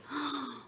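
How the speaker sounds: sharp mock gasp for the kids; dramatic teacher gasp. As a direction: gasp as if surprised. Gasp at story moment.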